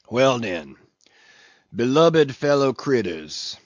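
mumbled dialect sermon to the sharks; a sullen old ship's cook preaching to sharks; mumbling old cook. Acted. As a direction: grumble it out, half to himself. Fleece's sermon voice.

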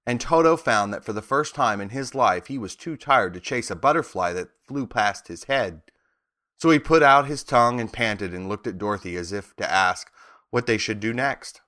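ordinary delivery, nothing marked

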